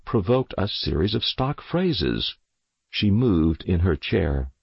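usual speaking voice

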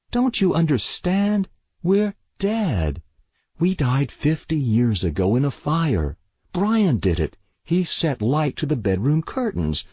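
high, urgent insistence; distressed girl. A narrator's reading. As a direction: plead to be believed, pitched well up. Distressed girl insists they are dead.

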